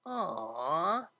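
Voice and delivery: a drawn-out disappointed whine; whined aw. Acted. Vinyl whines as Octavia gets up to leave. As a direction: let it slip out, reluctant to see her go. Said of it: "whined the D J".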